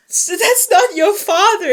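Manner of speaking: hammed-up dramatic realization; gasping mock shock. Retelling the reveal about the father, mock shocked.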